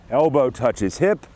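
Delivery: ordinary talk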